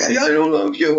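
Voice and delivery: lightly voiced child; child's complaint. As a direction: whine it like a sulky kid.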